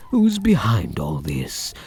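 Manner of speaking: ordinary delivery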